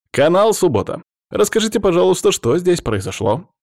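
high, loud TV presenter's pitch; eager reporter. A narrator's reading. TV reporter pushing a microphone at a rescuer, eager. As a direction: announce the channel, then press for answers.

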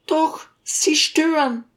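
high, slow contradiction in character; insistent lady. A narrator's reading. Lady tells the teacher he is disturbing, insistent. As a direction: contradict him slowly, voice pitched high.

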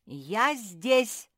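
loudly declared presence; bold, loud call; loud announcement. A narrator's reading. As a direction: announce yourself at full voice. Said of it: "громко сообщила Мафи".

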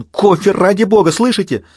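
high, loud panicked plea. Acted. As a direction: beg into the radio in panic. Garcia panicking on radio.

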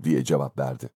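ordinary delivery, nothing marked

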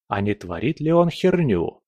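ordinary delivery

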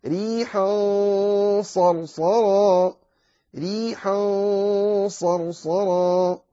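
صرصرا with heavy, emphatic ص, slow and drawn; slow Quranic recitation. Shown as a demonstration. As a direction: chant ريحا صرصرا slowly, ص heavy. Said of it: "تظهر الاستعلاء".

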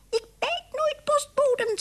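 very high, tiny mouse voice; squeaky mouse. A narrator's reading. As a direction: squeak a reply to the postman. Mayor answers as a mouse.

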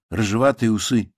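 plain reading voice

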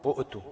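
"أؤتوا" split by a glottal catch; short u, stop, tu in "أؤتوا"; original sounded hamza. Shown as a demonstration. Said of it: "أصلها".

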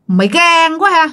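eerie high-pitched threat; creepy possessed voice. Retold or imitated. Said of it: "cái giọng anh hai thấy ghê lắm".

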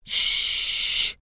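long unvoiced "shh"; a sharp hiss for silence; shushing. A narrator's reading. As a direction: hush someone with a drawn-out shh. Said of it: "لتسكتني وقالت".

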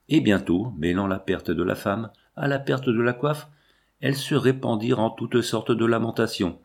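plain reading voice

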